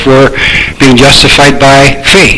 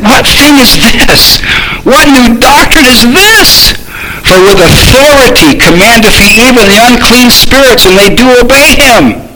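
amazed reading; 8 semitones higher than usual. Acted. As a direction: read it as a crowd stunned by what they saw. Reads amazed crowd's questions.

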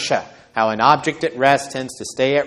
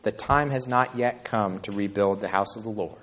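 quiet rebuke; 4 semitones lower, 7 dB quieter than usual. Retold or imitated. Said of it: "It's like he's saying".

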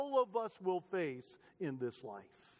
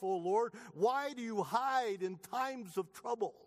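raised, urgent lament read aloud; preacher voicing the psalmist's anguish; pleading psalm reading; 5 semitones higher than usual. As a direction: cry out the psalm like your own complaint.

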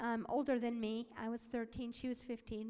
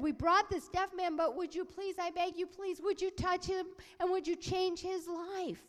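high, urgent begging; pleading; 7 semitones higher, 6 dB louder than usual. Retold or imitated. People beg Jesus for a deaf man, urgent pleading. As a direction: implore with raised pitch and energy. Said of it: "because they beseeched him".

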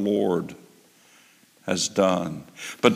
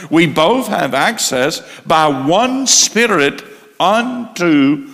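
preaching at full force; 5 semitones higher, 5 dB louder than usual. Acted.

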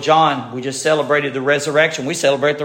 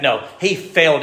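ordinary talk, nothing marked

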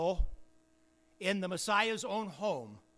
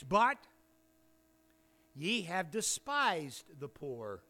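scripture reading; 3 semitones higher than usual. Real, not acted. Preacher puts James's questions to the congregation, emphatic scripture reading. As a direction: build each question to a raised, weighty ending.

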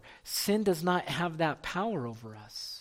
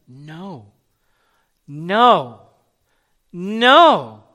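getting louder; 12 dB louder, much slower than usual. Shown as a demonstration. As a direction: build each no into a forceful shout. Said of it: "gets a little more louder as we go".

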